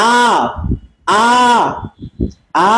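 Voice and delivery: long open আ, mouth spread wide; demo vowel a. Shown as a demonstration. Teacher leading students to say আ. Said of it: "সবাই আমরা বলি".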